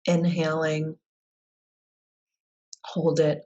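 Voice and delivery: unhurried guided-visualization voice with long pauses; guided meditation. Real, not acted. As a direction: lead them calmly through it, leaving space between phrases. Leads a guided visualization exercise.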